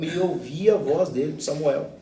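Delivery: ordinary talk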